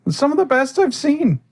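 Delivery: a high, bright fairy lady; high NPC voice. Acted. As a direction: chirp it sweetly like a fey host. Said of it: "Ruby goes".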